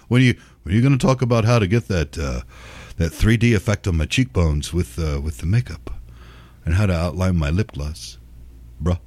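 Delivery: low, dopey confused bro; mocking a confused young viewer, low and dull; dumb bro voice. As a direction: drop low and play a lost bro. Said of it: "bro viewer continues".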